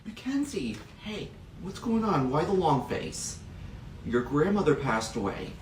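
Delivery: soft, hushed sympathy; tender comfort. Acted. Teacher softly consoling a girl whose grandmother died. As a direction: console a grieving girl gently.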